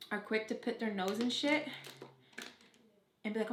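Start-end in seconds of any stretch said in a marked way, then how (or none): none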